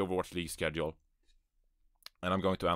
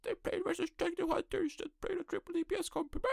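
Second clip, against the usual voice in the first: high mock voice of fans objecting; mocking doubter. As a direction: mimic a know-it-all critic in a shrill falsetto. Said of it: "some people will be like".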